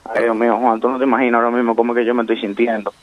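ordinary talk

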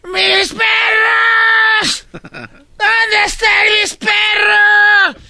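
long, high wailing shout of mis perros; drawn-out anguished yell. Retold or imitated. Host mimicking the frantic traveler at the airport, drawn-out wail. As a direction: wail it out like a desperate owner. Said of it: "Oigan lo que grité".

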